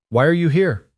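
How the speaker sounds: a hint of a small boy asking; curious little boy. Nephew questioning his uncle, lightly voiced child.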